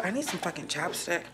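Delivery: normal talking voice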